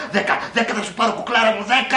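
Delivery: high, loud mock boasting; hammed-up urgent lover; eager film lover. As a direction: play the man promising wildly. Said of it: "Αυτός".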